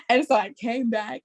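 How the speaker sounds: ordinary talk, nothing marked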